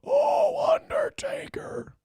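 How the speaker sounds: very high cry, then high whispered Undertaker; Paul Bearer voice. Retold or imitated. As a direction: wail oh then whisper the name slowly. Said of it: "I can't go".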